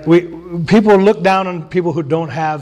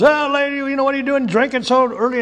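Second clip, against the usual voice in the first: imitating Eli; 9 semitones higher than usual. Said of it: "and he goes".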